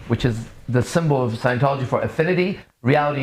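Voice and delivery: normal talking voice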